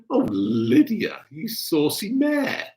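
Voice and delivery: high, drawn-out playful scandal; slow, high-pitched ribbing; teasing mock shock. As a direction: draw it out slowly to tease her. Teasing viewer about being on the nest.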